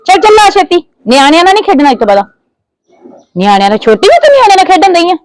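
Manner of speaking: loud, high-pitched furious scolding; angry scolding. Acted. Aunt berating the niece left in her care, shouting angrily. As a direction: tear into the girl, shouting.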